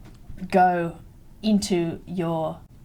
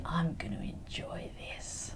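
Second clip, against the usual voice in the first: slow, very soft menacing whisper; 3 semitones lower, 16 dB quieter, slower than usual. Acted. Catfish in the poisoned heckler's ear, whispering. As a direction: breathe it slowly into the victim's ear. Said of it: "he whispers and he says".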